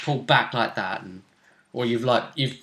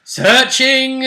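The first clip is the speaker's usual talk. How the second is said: high, loud sing-out; called out; 11 semitones higher, 8 dB louder than usual. Retold or imitated. Game master re-enacting a player declaring an action, loud high call. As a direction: announce it loudly, stretched and high.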